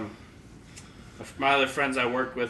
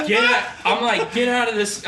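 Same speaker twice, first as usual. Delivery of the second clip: louder, raised "get out"; urgent commands; 6 semitones higher, 5 dB louder than usual. Retold or imitated. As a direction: bark it at the kid in a panic.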